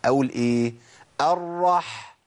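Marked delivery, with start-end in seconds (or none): 1.2-2.2 «الرح» ending in a long breathy ḥ; firmly placed ḥ. Shown as a demonstration. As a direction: press the final ḥ of «الرح» out from mid-throat. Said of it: "ولازم أمكن لمخرج الحرف". («الرح» بحاء متمكنة من وسط الحلق)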